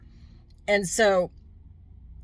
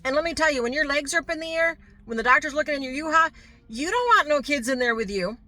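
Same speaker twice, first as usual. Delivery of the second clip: higher and louder, indignant and quick; emphatic outburst; 9 semitones higher, 7 dB louder than usual. Real, not acted. Vlogger telling women viewers about a checkup with kids present, loud indignant outburst. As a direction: let it burst out, half laughing in disbelief.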